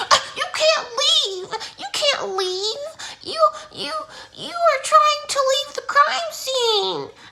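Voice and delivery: high, loud, slow, laughing mock scold; mocking lady. Neighbor's wife stopping the girl leaving, high mocking scold. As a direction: imitate a shrill lady laughing.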